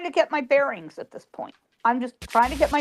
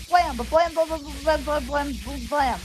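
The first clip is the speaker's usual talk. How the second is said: rapid run of vocal gunshots, starting higher; imitated gunfire; 3 semitones higher than usual. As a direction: rattle off mock gunshots, fading as they go. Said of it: "all I hear behind me is gunfire".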